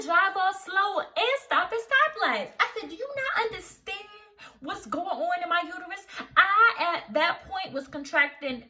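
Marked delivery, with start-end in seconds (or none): 2.9-6.1 high, fast exasperated snap; exasperated scolding. Retold or imitated. Scolding her husband for stopping at stoplights. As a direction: scold him in disbelief.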